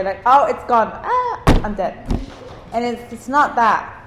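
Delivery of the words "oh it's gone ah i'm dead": mock despair. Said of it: "we could think that way".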